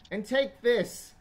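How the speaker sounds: loud, high-pitched outburst; exasperated skeleton. Acted. Skeleton fobbing off the intruder, exasperated yell. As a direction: blurt it high and loud to get rid of them. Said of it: "He's like".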